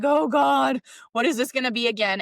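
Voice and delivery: loud, worried outcry that rises; alarmed cry. Retold or imitated. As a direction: panic as the water comes up the street. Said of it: "And so we're like".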